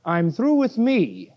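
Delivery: ordinary talk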